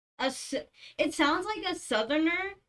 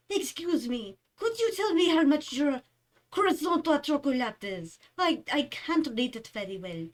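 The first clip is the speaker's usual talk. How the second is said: imitated French accent, pitched up a little; 4 semitones higher than usual. Customer asking a bakery worker the price, in a French accent. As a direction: imitate a French customer, lighter than the original. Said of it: "she sounded, like, more French than that".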